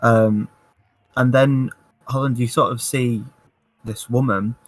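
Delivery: normal talking voice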